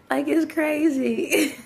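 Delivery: high, slow words squeezed through laughter; real laughing. Real, not acted. Vlogger recovering from own joke, laughing. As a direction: still cracking up.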